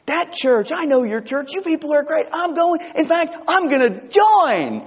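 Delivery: high, bright-eyed churchgoer gushing; lightly voiced enthusiastic stranger; eager visitor voice. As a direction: gush like a delighted new visitor. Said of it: "You want people to say".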